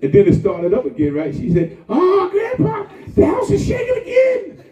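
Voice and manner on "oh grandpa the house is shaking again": put-on high, loud, laughing child's alarm; scared granddaughter. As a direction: play the frightened little girl calling Grandpa.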